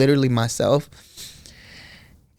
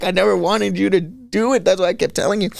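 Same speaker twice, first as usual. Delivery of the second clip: mildly upset parent, pitch raised; higher, pleading parental voice; tearful parent; 6 semitones higher than usual. Retold or imitated. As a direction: retell comforting a hurt child through tears. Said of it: "crying too".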